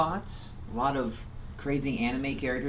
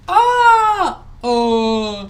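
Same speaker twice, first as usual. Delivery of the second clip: high surprised yelp; 7 semitones higher, 11 dB louder than usual. Retold or imitated. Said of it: "She's like".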